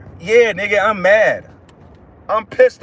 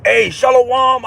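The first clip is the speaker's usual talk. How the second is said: mock pious greeting; 5 semitones higher, 4 dB louder, slower than usual. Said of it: "You think I be".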